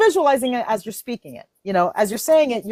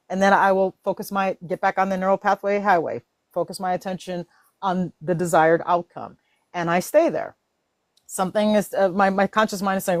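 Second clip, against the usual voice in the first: ordinary talk, nothing marked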